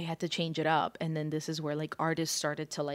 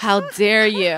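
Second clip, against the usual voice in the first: mock outrage; 4 semitones higher, 12 dB louder than usual. Mock outrage at friend's joke.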